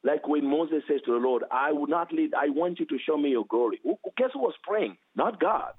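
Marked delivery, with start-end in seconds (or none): none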